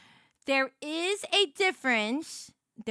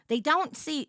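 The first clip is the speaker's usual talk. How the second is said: ordinary delivery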